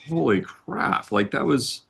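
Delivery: put-on high, rising alarm at old news; shocked disbelief. Telling wife about the upset, stunned and high. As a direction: blurt it out in stunned surprise. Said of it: "that's where my head went".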